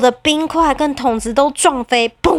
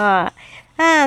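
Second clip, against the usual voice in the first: plain reading voice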